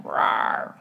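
tiny breathless little roar; a weak boy's roar back at the bear; puny roar. Retold or imitated. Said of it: "this very puny".

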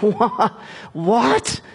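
high-pitched, stunned disbelief; retold alarm, climbing high; acted shock. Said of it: "Isaac began to tremble uncontrollably".

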